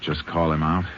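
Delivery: ordinary talk, nothing marked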